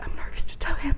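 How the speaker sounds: whispered, as if hiding from the phone; nervous whisper. Retold or imitated. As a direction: hush it, scared of being heard.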